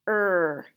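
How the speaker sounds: R-E-D-E as four separate sounds, long gaps; tapped sound by sound. Shown as a demonstration. Teacher tapping out ready, R-E-D-E sounded in pieces. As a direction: sound out R-E-D-E one sound at a time. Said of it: "Let's tap it".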